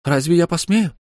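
light female character voice; Lyu's voice. A narrator's reading. Lyu's voice read aloud.

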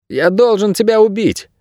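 put-on high-pitched child's voice; distraught boy. A narrator's reading. Boy aiming his bow at Найрод in tears, distraught. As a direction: declare it in a high boy's voice.

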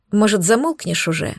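ordinary delivery, nothing marked

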